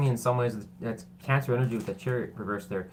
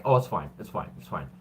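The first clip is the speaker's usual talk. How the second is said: stubborn brush-off; 6 semitones lower than usual. Acted. Stubborn people refusing to fix it, mild brush-off. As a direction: wave the problem away as you fade. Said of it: "they're just stubborn".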